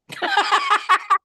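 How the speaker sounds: high burst of laughter. Real, not acted. Caller laughing at the friend's question, high laugh. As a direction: laugh it off brightly.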